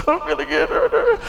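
put-on a frightened kid in his room squeaks to himself; squeaky kid voice. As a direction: squeak it like a nervous young boy. Scared kid waiting in room.

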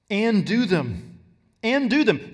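insistent stress on do. Shown as a demonstration. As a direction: do punched hard and slow. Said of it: "they're emphasizing the last few words".

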